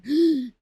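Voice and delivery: sharp, loud gasp into the mic. Pretend. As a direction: gasp in hard for breath. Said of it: "Breathing A S M R". (malakas na hingal)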